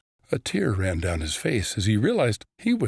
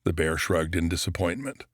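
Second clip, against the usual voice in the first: plain reading voice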